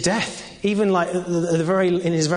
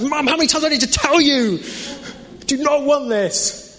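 put-on much higher, louder, fed-up kid, last words slowed; exasperated kid; 10 semitones higher, 3 dB louder than usual. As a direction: whine it out at mum, stretching the end. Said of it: "I was like".

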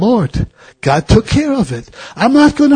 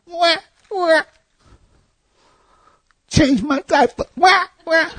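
high mock baby wail, softer; crying baby; 12 semitones higher, 4 dB quieter than usual. Preacher mocks fussy Christians as a high whiny baby. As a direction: whine like a bawling baby. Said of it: "kick your feet like a baby".

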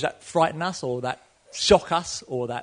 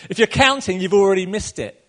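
loud and higher, driving the point home; preacher's emphatic punchline; 5 semitones higher, 8 dB louder than usual. Real, not acted. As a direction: hammer the lesson. Punchline of the sermon.

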